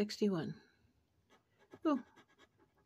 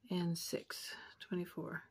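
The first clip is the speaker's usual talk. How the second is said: whispering; 2 semitones lower, 9 dB quieter than usual. Real, not acted. Checking numbers under breath.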